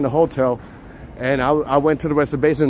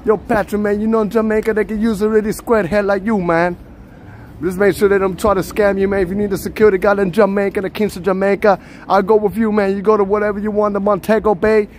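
higher, lively mock Jamaican accent; fake Jamaican accent; 5 semitones higher than usual. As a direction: play the Jamaican tour guide, accent laid on thick. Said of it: "we were talking Jamaican".

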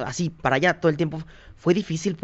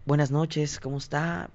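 muy formal; 7 dB quieter, slower than usual. Retold or imitated. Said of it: "¿Muy propio?".

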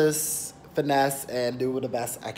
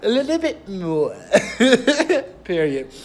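put-on slow, higher, laughing through a playful DC accent; 7 semitones higher, 7 dB louder than usual. Vlogger laughing at his own DC accent, slow laughing DC accent. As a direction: drawl it in a DC accent, cracking up. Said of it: "That's my D C accent".

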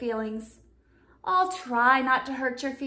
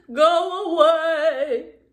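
slow, louder, put-on shark voice; sulky monster voice; 3 semitones higher, 8 dB louder, much slower than usual. Sulking shark telling friends to leave, grumbling slowly. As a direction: drag it out like a grumpy sea beast.

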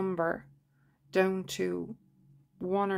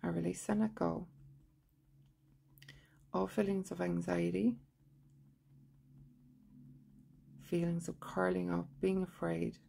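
measured monotone affirmations with long pauses; guided tapping recitation; 7 dB quieter than usual. Shown as a demonstration. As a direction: recite each phrase evenly and quietly, leaving room to repeat.

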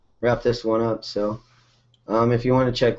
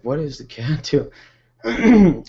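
surprised; 4 semitones higher, 5 dB louder, slower than usual. Retold or imitated. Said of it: "I was like".

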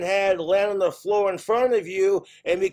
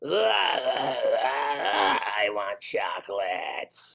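very low, slow demonic growl; guttural exorcism-movie demon voice; possessed child; 8 semitones lower, 3 dB quieter, much slower than usual. Acted. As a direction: drag it out like a demon-possessed kid's tantrum. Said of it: "an exorcist".

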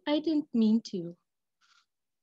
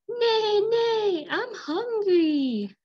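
higher, slow, crying neigh into words; whimpering hungry horse; 7 semitones higher, 3 dB louder, much slower than usual. Acted. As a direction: sad little horse asking for food. Horse says she's hungry.

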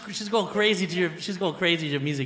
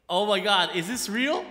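usual speaking voice

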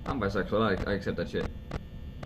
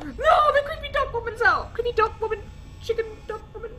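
very high, half-laughing panic; high-pitched frightened wail; panicked squeal. Real, not acted. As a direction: squeal for rescue in giddy terror. Panic as creepy duck appears.

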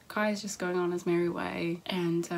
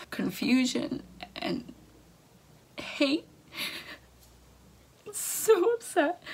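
high, slow, breaking voice; crying out the feelings one by one; tearful words dragged out slowly; 7 semitones higher, slower than usual. Real, not acted. Reliving the lost pregnancy.

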